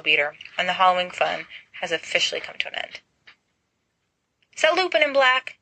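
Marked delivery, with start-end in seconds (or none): 4.5-5.6 high and gleeful; delighted. A narrator's reading. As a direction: savour the rival's trouble. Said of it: "sounding delighted".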